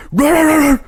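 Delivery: high, rolling mock bark; imitated dog snarl. Collie turning on the dog humping him, snarling bark. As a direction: bark like a dog snapping back.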